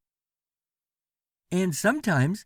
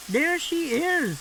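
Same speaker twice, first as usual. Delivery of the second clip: put-on much higher, eager child; Nicky spotting the cat; excited shout; 11 semitones higher than usual. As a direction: point her out with glee. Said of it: "shouted Nicky".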